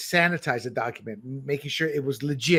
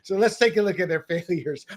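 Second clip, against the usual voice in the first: usual speaking voice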